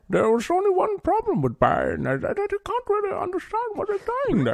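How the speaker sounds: muffled, theatrical villain voice through a mask; Bane impression. Podcaster mocks Bane's hard-to-understand voice, in a muffled villain impression. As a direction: boom it out like a masked villain. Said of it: "tried to do that impression".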